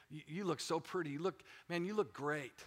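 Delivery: gently sincere, haltingly admiring; tender fatherly praise. Retold or imitated. Father telling his little daughters they look pretty, soft and tender.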